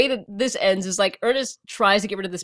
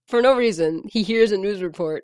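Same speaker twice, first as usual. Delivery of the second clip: ordinary talk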